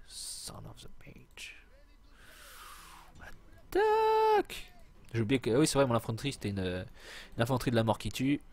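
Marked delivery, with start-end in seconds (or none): 0.1-1.6 mock whispered curse. Mock insult whispered at game. 3.7-4.7 mock battle cry. Game command voiced high.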